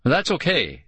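plain reading voice

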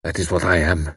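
low, bitter admission; a touch of bitterness; bitterly. A narrator's reading. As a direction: spit out the self-judgement. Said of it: "Karl went on bitterly".

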